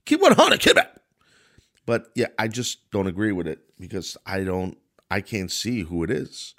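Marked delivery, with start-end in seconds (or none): 0.1-0.9 high, loud mock-hype shout, peaking on the repeat; kids' slang catchphrase. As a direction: holler it like an overexcited kid, playfully hammed up. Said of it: "as kids say".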